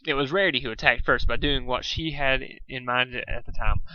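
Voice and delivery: plain reading voice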